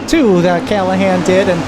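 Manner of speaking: ordinary delivery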